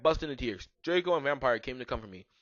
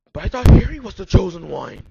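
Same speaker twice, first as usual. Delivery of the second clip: loud, high outburst of disbelief; shocked; 6 semitones higher, 18 dB louder than usual. A narrator's reading. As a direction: blurt it out after a gasp. Shocked line after a gasp.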